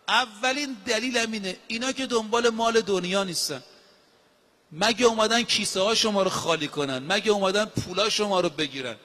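ordinary delivery

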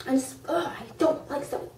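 high, exasperated groan of dislike; annoyed disgust. Real, not acted. Fan venting about annoying Zoe, exasperated. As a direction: let real irritation spill out.

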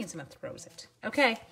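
usual speaking voice

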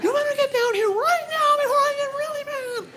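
very high, scolding mom without volume; wife's pretend yell. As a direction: threaten the boys in a high, feeble yell. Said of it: "I'll make her yell sometimes".

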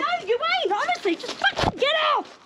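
high-pitched angry outburst; furious protest. Real, not acted. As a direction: snap back, voice climbing high. Argument over car being taken.

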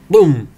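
loud mock boom; explosion sound effect. A narrator's reading. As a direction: shout a boom. Narrator imitates explosion.

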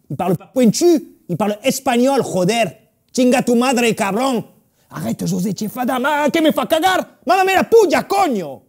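loud, high, escalating rant; worked-up mock tirade; indignant outburst. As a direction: swear in Spanish at rising pitch. Acted outburst with Spanish swearing.

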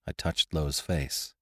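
plain reading voice

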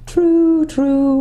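whirring owl. A narrator's reading. Glimfeather answering Jill, high hooting owl voice. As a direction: hoot it like an owl. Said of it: "whirred the owl".

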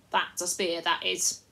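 lightly voiced rustic chief, earnest and worried; a simple country fellow pleading his case; a hint of a rough country leader.

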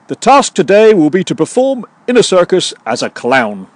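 high-pitched mock TV task briefing; Apprentice boss. Boss setting the Apprentice task, mock-grand announcement. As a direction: announce it like a reality show boss.

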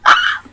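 very high, loud short shriek; sudden squeal. Acted. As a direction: burst out in a tiny shriek. Goofy outburst after giggling.

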